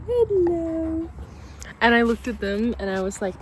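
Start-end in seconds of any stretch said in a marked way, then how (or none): 0.1-1.1 much higher, sing-song pet voice. Real, not acted. Owner cooing at her dogs, higher baby-talk hello. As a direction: coo it up high at the dogs.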